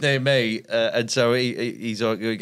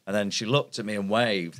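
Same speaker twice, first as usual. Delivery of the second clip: normal talking voice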